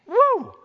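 high, sudden "woo"; high-pitched holler of delight; excited whoop. Real, not acted. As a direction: let out a thrilled whoop. Excited reaction to God's words.

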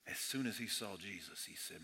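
hushed retelling of Thomas's words. Real, not acted. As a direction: pull the room in close and quiet. Preacher drops to a hush.

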